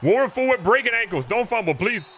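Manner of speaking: excited call. Real, not acted. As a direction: call the juke like a fan losing it. Quarterback jukes a defender.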